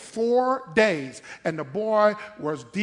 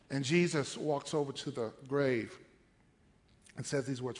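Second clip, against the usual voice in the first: ordinary talk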